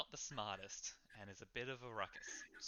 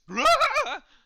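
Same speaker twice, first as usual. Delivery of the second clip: sudden loud, high yell of fright; huge startled shriek; startled scream; 22 dB louder than usual. Acted. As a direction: scream like someone thrown backwards off a chair. Patrons flung back from their chairs, scared.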